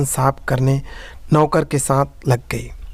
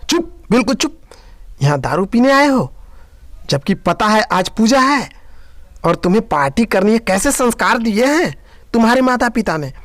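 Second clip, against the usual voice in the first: stern rebuke; 5 semitones higher than usual. Acted. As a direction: silence the drunk friends hard. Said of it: "घूरते हुए कहा". (सास का दोस्तों को ऊंचे स्वर में गुस्से से डांटना)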